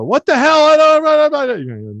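high, loud yell of indignation; outraged outburst. Acted. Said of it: "they can go".

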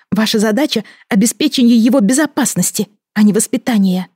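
rising, indignant royal rebuke; angry outburst. Acted. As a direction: snap back at him, furious. Queen angrily rebukes the guard.

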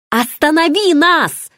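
high-pitched desperate cry; yelling. A narrator's reading. Falling woman begging the man to stop them, yelling. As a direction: cry out a frantic command. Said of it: "заорала ему я".

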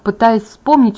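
usual speaking voice

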